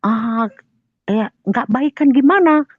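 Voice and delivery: high, loud retort of disbelief; spontaneous reaction. Retold or imitated. Teller questions in-law about the quarrel, startled. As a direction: react at once, voice jumping up. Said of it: "Aku dengan spontan ku bilang begini".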